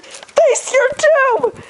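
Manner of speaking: slow, high, loud doom cry; villain battle cry. Pretend. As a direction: cry out doom at the bird, hammed up. Attacking the bird in mock battle.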